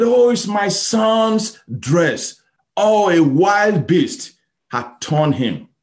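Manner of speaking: slow, high lament of a father who lost his son; grieving father. Acted. Father grieving over his son's torn dress, slow and high. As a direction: draw it out, heartbroken and high.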